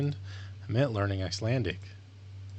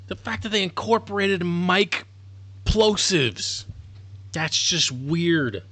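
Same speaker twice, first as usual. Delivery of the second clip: much higher, slowing at the end; upset disbelief; 7 semitones higher than usual. Real, not acted. Learner unsettled by the AI voice, pitched up. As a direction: stretch out the disgust.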